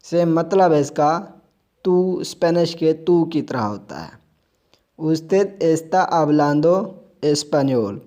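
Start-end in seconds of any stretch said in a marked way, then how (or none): 5.0-8.0 very slow, careful reading for learners; slow model sentence. Shown as a demonstration. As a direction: lay each word down slowly. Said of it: "अदब के लिए".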